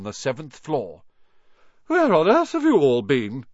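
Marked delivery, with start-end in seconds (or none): 1.9-3.4 scolding lady. Acted.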